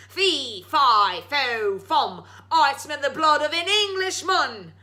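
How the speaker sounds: booming roar; giant's roar. Acted. Waking giant roaring at Jack, booming. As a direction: roar it out at the thief. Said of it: "the giant roared".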